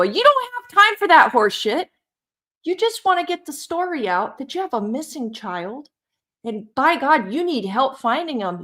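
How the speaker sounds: heated, high-pitched scolding rant; real indignation. Real, not acted. Host scolding a missing boy's mother for faking an accent, indignant.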